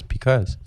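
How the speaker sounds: "because" starting with a P; mom's because. Retold or imitated. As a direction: say "because" as "pecause". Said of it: "With a P".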